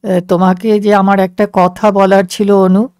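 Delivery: low voice from long ago; man from the past. A narrator's reading. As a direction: speak up as the low-voiced man she remembers. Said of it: "একচল্লিশ বছর আগের মুখ বলছে".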